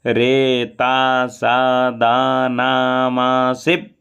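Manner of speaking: वारुणस्य stretched slowly; drawn out. Shown as a demonstration. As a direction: draw the syllables out slowly. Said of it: "थोड़ा खींच कर बोलेंगे". (थोड़ा खींचकर बोलें)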